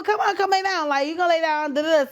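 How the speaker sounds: ordinary talk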